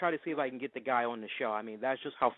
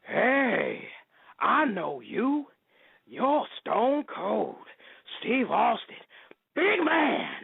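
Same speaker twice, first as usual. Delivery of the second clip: Al Pacino impression; 10 semitones higher, much slower than usual. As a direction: size up the big man, slow and cocky. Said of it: "What if Al Pacino crossed paths with Stone Cold".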